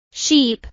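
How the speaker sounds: SHEEP with a long, tense ee; long vowel. Shown as a demonstration. Teacher drilling learners on vowel sounds, long ee in Sheep. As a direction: hold the ee in Sheep. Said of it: "is a long I sound".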